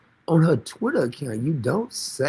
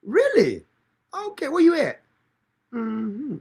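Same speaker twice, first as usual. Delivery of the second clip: higher, lightly voiced phone chat; mock phone call; 8 semitones higher than usual. Pretending to phone the Ayatollah in a lightly higher voice. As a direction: pretend to take a call from the dead. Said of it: "On the phone".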